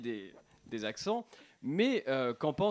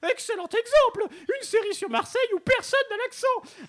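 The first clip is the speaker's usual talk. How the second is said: loud, very high-pitched Marseille outrage; Marseillais accent; 4 dB louder than usual. Acted. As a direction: rant in a shrieky Marseille accent. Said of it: "je reçois l'accent marseillais". (accent marseillais suraigu, outré)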